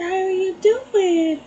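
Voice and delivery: high, slow, sing-song coo; drawn-out baby talk. Retold or imitated. Slowly cooing high at the groundhog that won't run. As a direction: stretch the greeting high and sweet.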